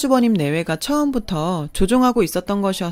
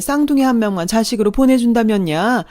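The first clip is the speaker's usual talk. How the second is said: raised, theatrical pleading; 3 semitones higher, 4 dB louder than usual. Acted. Mother-in-law begs for one of the twins, pleading. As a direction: press the plea, lifting into the final question.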